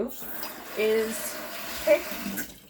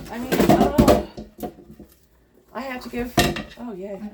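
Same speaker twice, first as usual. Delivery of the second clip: usual speaking voice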